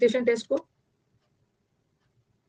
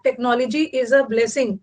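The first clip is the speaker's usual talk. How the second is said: slow dictation; much slower than usual. Shown as a demonstration. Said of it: "ऐसा आता है ना".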